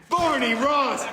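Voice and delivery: usual speaking voice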